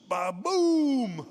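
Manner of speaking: loud, booming mock blast; explosion noise. Telling friends how the fire pit blew up, booming imitated explosion. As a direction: burst it out like the fire pit going up.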